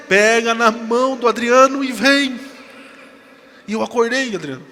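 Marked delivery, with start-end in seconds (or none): 0.1-2.4 loud, slow, raised summons; voice from the dream. Retold or imitated. Voice tells the frightened woman how to cross, loud slow summons. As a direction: proclaim it slowly as a commanding call. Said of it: "E a voz falou assim".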